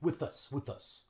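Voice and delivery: "with us" whispered, th flowing into us; quiet linked with us. Shown as a demonstration. Teacher softly repeating the linked phrase. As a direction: murmur "with us" as one unit. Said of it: "links on with the U".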